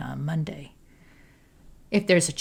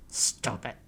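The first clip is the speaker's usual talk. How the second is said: low, soft growl; 6 semitones lower, 9 dB quieter than usual. A narrator's reading. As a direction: warn her off under your breath. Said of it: "Nina growls".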